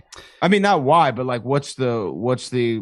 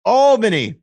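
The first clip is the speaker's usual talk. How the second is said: high, loud echo of the town name; louder, emphasized; 9 semitones higher, 6 dB louder than usual. Shown as a demonstration. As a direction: repeat it loudly for emphasis. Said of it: "repeating it louder".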